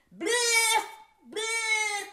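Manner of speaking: high, loud silly nonsense yell; silly shout. Pretend. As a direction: blurt it out like a cartoon. Silly nonsense word outburst.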